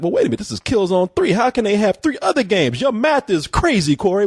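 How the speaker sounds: high, fast mock protest; high, quick jokey whine of disbelief; mock incredulous listener. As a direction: squeak out the objection at a rush. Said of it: "And you're like".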